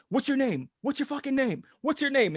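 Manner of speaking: high, rapid-fire imitated interrogation; overzealous cop. Retelling cop questioning a frightened kid, high insistent demands. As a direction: mimic a cop hammering out demands. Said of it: "this fucking overzealous cop".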